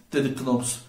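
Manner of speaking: ordinary delivery, nothing marked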